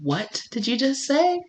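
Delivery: soft and high, taken aback; startled quiet voice. Retold or imitated. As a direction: ask it stunned and hushed. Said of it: "they're like".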